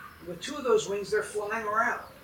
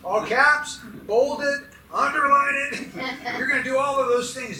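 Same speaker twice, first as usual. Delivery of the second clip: loud, higher, punched out phrase by phrase; emphatic; 5 semitones higher, 7 dB louder than usual. Shown as a demonstration. Preacher lists ways to get attention, loudly emphatic. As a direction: hammer each one harder, drawing out the last. Mimics emphatic formatting for laughs.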